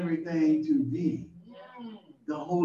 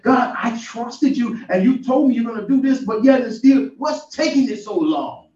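higher, pleading complaint; complaining to God; 7 semitones higher, 4 dB louder than usual. Retold or imitated. A believer complaining to God, higher and pleading. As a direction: press God with a hurt grievance.

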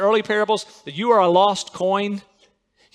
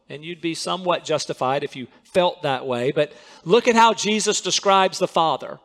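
ordinary talk

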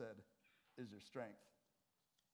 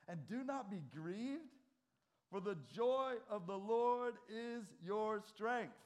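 proclaiming Nehemiah; 5 semitones higher than usual. Acted. Preacher reading Nehemiah's words to mourners, slow proclamation. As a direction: proclaim it like a prophet to the crowd. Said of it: "Then he said to them".

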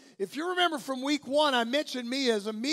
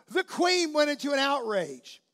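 ordinary talk

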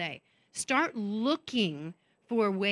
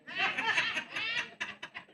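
high laugh; 10 semitones higher, 5 dB quieter than usual. Real, not acted. Laughing at own remark.

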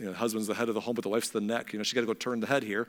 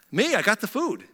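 higher-pitched pretend son; impersonating a brother; 7 semitones higher, 4 dB louder than usual. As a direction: announce yourself high, passing as someone else. Jacob posing as Esau.

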